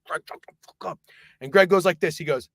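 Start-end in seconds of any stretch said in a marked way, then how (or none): 0.1-1.0 harsh, voiceless warning; desperate whispered shushing; urgent whisper. Retold or imitated. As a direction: whisper it so the next table can't hear. Others shushing Greg.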